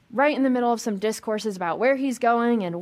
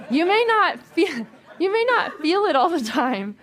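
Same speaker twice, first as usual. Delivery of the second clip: with belief and energy; 6 semitones higher, 5 dB louder than usual. Shown as a demonstration. Preacher urging the congregation to say it with energy. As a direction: say it like you truly believe it. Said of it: "Let's say it with, like, belief and energy".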